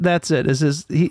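ordinary delivery

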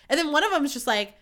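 ordinary talk, nothing marked

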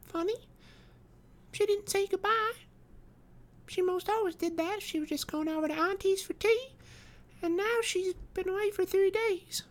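put-on very high, piping child voice; small, high little-girl voice, wondering. A narrator's reading. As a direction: chatter innocently as a puzzled five-year-old.

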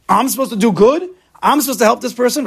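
high, loud indignant disbelief; incredulous mind. Shown as a demonstration. Teacher voicing the mind's objection, high and indignant. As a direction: voice the mind's outrage at the idea. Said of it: "I emphasized the difficult parts purposely".

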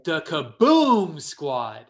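stretched, loud BOOM on Kaboom; milk "Kaboom" for the crowd, long and booming; booming announcer-style name. Pretend. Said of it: "Can you say it again?".

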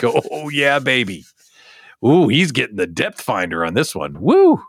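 whooping boat buyer living it up; gleeful lottery winner. Pretend. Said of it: "they're just like".

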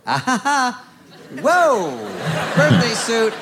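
high, loud nervous awkward guy. Retold or imitated. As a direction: squeal it out like a flustered nerd. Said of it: "the awkward guy, which I am".

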